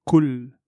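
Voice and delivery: "kul" with a plain k, slow; kaf instead of qaf. Shown as a demonstration. Teacher shows how "kul" changes the meaning, slow plain k. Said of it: "on dit kul".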